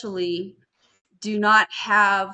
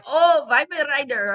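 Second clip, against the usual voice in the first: a light mock German accent, higher and louder; imitating German accent; 6 semitones higher, 5 dB louder than usual. Imitating the tourists saying the dog breed, light German accent. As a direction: echo "Weimaraner" like the German tourists did. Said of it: "in their accent".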